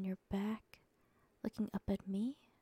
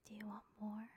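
barely audible whisper, much softer; hushed whisper; 12 dB quieter, faster than usual. A narrator's reading. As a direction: breathe the question right into his ear. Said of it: "I whispered in your ear".

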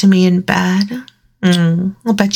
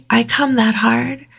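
usual speaking voice

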